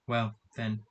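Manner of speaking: plain reading voice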